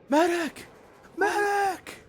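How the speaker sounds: high, strained shouting for help; desperate call for medic. Acted. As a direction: yell for a medic across the battlefield. Wounded soldier calling out.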